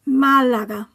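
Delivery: Málaga with MÁ stressed; stress on accented syllable. Shown as a demonstration. Teacher modelling written accents, Málaga stressed on first syllable. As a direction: say Málaga in Spanish, MÁ-la-ga. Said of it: "stress the syllable that the accent is on".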